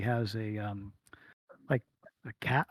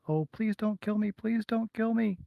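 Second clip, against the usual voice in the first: put-on much higher, frightened small girl; pleading yell; 10 semitones higher, 3 dB louder than usual. Captured small girl begs the party not to kill her, high frightened pleading. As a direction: plead for mercy in a high little voice.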